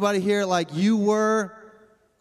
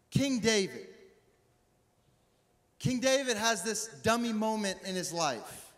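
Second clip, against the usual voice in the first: ordinary talk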